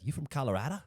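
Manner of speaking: high-pitched mock Australian accent; botched New Yorker that comes out Australian; accidental Australian accent. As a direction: question in a put-on Australian accent.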